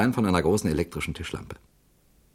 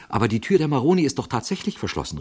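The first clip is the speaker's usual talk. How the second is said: quick, higher astonished cry; 8 semitones higher, faster than usual. Acted. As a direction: blurt it out in surprise. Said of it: "rief Jarvis erstaunt".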